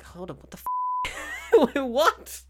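squeaky high, slow disbelief; incredulous what the. Retold or imitated. Vlogger mocking his own reaction, squealing what the. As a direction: stretch it up high in total disbelief. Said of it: "I'm like".

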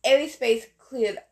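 ordinary delivery, nothing marked

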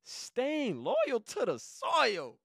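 proud title reveal, stretched slow; slow proud announcement. Shown as a demonstration. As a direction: drag out "Staying loyal to the soil" like a title. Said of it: "guess what I titled it".

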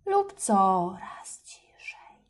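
slow, fading whisper demo. Shown as a demonstration. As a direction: let "ciszej" sink to a whisper. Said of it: "dziecko musi mówić coraz głośniej".

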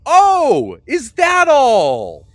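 high, loud, sarcastic sing-song; mock relieved hostess. Missus Fox scoffing at the party's small request, high sarcastic sing-song. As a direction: drag out is that all slowly, dripping with sarcasm.

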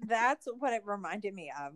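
ordinary delivery

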